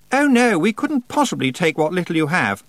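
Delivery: high-pitched, affected politeness; gushing polite refusal. A narrator's reading. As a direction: refuse in an overdone, high society-guest voice. Anagramma makes a polite refusal.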